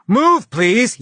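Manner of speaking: loud, high yell across the room. Acted. Customs agent at the exit yelling at the traveler, loud yell. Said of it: "yelled another customs agent".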